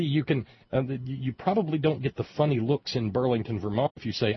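normal talking voice

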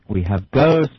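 ordinary delivery

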